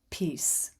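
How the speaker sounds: normal talking voice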